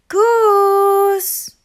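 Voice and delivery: high, loud long call. A narrator's reading. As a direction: call across the meadow for a lost friend. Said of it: "Duck called out to his friend".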